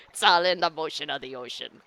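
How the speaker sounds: put-on weird pirate accent. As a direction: play a goofy pirate accent. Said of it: "Some weird pirate accent".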